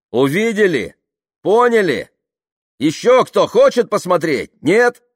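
loud, high, forceful questions; challenging the crowd. A narrator's reading. Tamara showing the waybill to the crowd, loud challenge. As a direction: dare anyone to argue.

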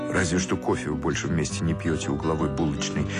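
usual speaking voice

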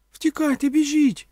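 very high, loud panicked cry; frightened squeal. A narrator's reading. Donka urging Omela to run, squealing in fear. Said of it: "перелякано запищала Донка".

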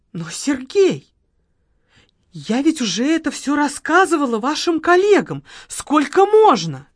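fed-up plaintive woman; weary widow. Acted. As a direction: whine that she's told it before. Margarita complaining to the marquis.

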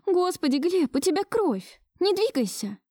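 high-pitched, urgent alarm; alarmed woman. A narrator's reading. Marusya seeing Gleb's blood, alarmed. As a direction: order him still in a high, worried voice. Marusya finds him bleeding.

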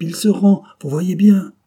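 high, loud gleeful remark; dying man delighted, pointing it out; joyful. A narrator's reading. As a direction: gloat with triumph. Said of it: "observa joyeusement Ossip".

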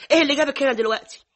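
angry mother's rebuke; scolding. Acted. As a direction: snap at the intruding daughter. Mother scolds daughter for intruding.

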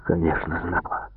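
low, hushed admission; muted, beaten-down confession; subdued prisoner. A narrator's reading.

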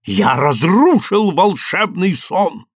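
high, slow, grand boast; proud declaration. A narrator's reading. Guest proclaims himself Ivan-tsarevich, proudly. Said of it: "гордо заявил гость".

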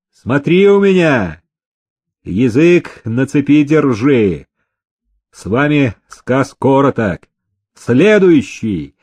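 firm overseer's warning; stern orders. A narrator's reading. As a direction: lay down the law to a workman. Overseer sternly assigns and warns.

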